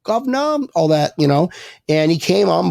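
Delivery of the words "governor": British accent impression. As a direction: ham up a cheery British bloke with the British accent. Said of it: "So he's like".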